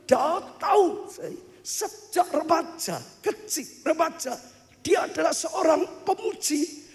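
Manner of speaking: high-pitched, drawn-out sermon delivery; impassioned preaching. Real, not acted. Preacher telling the congregation God loves praise, impassioned. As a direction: preach with soaring fervour, stretching key phrases.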